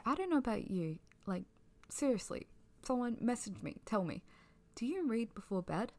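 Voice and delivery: normal talking voice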